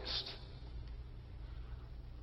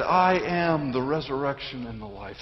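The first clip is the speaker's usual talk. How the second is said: soft, lowered scripture quote; hushed recollection; 6 dB quieter than usual. Acted. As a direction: recall it quietly, letting the voice drop.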